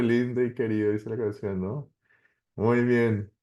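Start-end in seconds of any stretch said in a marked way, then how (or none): none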